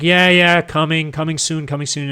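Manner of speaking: high, loud breezy brush-off of a boss stalling; dismissive brush-off. Retold or imitated. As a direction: toss it off lightly, like an empty promise. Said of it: "And he's just like".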